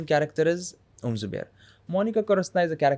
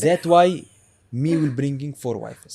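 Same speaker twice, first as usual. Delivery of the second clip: put-on broken-English father character accent; lightly voiced old father with a heavy accent; Abu Zubair accent; slower than usual. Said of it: "He has an accent".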